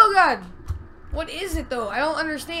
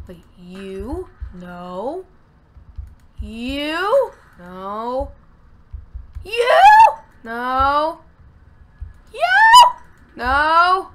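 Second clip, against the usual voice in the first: each you and no louder till the last one is a full shout; building to a yell; 8 dB louder, much slower than usual. Real, not acted. Escalating frustrated item search.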